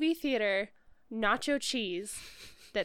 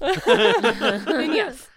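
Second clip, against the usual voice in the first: loud laughing answer; 5 semitones higher, 12 dB louder than usual. Real, not acted. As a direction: burst out laughing.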